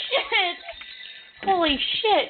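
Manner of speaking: high, breathy startled swearing; startled curse. Real, not acted. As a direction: blurt it out high in shock. Startled by the bee.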